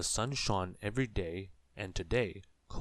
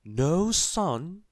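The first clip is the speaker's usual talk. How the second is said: very high, loud astonished cry; astonished exclamation; 9 semitones higher, 9 dB louder than usual. A narrator's reading. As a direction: gasp it out in amazement. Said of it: "exclaimed the wizard".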